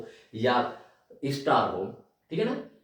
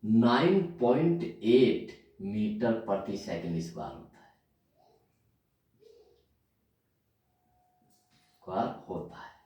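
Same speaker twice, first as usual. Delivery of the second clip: slow dictation. Shown as a demonstration. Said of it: "पूरा लिखता हूं".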